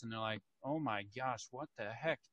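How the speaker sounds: hushed and low, a mock-shocked whisper. Officer spying through binoculars, hushed. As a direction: murmur it under your breath.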